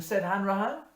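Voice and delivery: loud, high, ringing recital; bush poem recitation. Acted. Reciting Says Hanrahan for parishioners, loud declaiming. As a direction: declaim the ballad to the whole parish.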